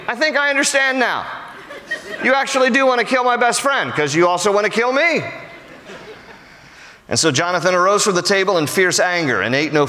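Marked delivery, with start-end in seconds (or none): 0.1-5.2 rueful son. Pretend. Jonathan realizes father's intent.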